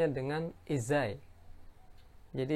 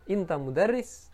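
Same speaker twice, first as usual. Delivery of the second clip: "Inta mudarris?" with a clear rise on "mudarris"; rising question tune on "mudarris"; questioning intonation; 5 semitones higher than usual. Shown as a demonstration. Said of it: "jadi ada intonasi bertanya".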